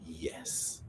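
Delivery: hushed, dramatic judge's reveal; imitated judge, barely voiced; suspenseful verdict. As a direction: drop it very soft after the suspense. Acts out judge's verdict after suspense.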